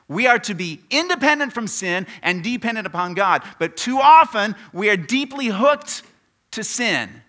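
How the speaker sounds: loud, high preaching voice; emphatic repeat. Real, not acted. Preacher repeating his point to the congregation, loud and emphatic. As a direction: drive the point home at full volume. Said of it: "I better say that again".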